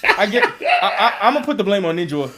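loud, high, laughing through the words. Real, not acted. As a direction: crack up while joking out the blame. Joking blame amid laughter.

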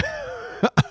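one sharp, very high burst of laughter; real laugh. Real, not acted. Recalling the mom's "don't remind me", a high laugh breaks out. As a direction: laugh out at the memory.

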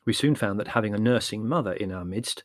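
ordinary delivery

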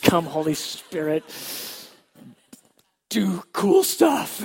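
fervent, hammed-up call to heaven; loud prayer. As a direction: cry out to the Spirit, drawn out. Acted prayer, calling out.

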